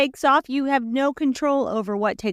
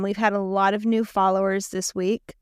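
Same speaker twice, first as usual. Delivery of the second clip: usual speaking voice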